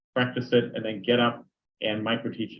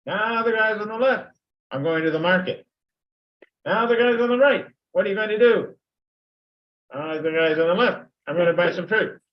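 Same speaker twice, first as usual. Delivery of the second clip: louder and higher, calling out to each half of the room; teacher directing the chant across the classroom; calling to class halves; 9 semitones higher, 5 dB louder than usual. Shown as a demonstration. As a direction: call to the right side, then the left. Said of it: "You can say".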